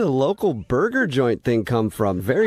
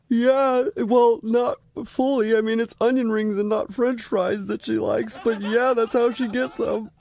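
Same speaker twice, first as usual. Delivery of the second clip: crying on the phone; 9 semitones higher than usual. Acted. Fake coach keeps acting hurt.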